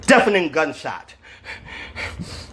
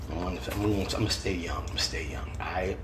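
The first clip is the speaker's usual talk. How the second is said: 1 joking whisper; 8 semitones lower, 16 dB quieter, faster than usual. Real, not acted. Joking aside, dropping voice.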